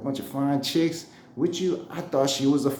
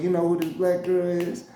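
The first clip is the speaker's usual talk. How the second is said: higher mock voice for the boss; playing up the boss's voice; imitating the boss; 6 semitones higher than usual. The boss asking about the woman, imitated voice. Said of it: "He don't even talk like that".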